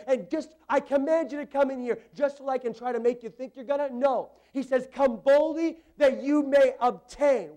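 loud, high, driving sermon delivery; preaching at full force. Acted. Pastor urging the church to pray boldly, preaching full force. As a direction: pound it out to the whole congregation.

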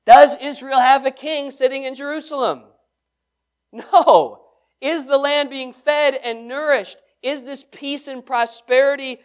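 preaching at full force. Acted. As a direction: drive the point home to the congregation.

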